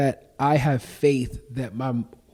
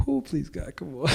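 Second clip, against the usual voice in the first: soft plea; 4 semitones higher, 8 dB quieter than usual. Retold or imitated. Pleading prayer retold.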